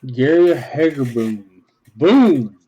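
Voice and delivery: talking around gum; words through a wad of gum; chewing gum. Real, not acted. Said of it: "I put the gum in my mouth".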